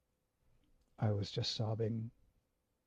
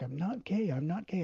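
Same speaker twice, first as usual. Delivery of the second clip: near tears; 7 semitones higher than usual. Retold or imitated. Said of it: "I just started crying".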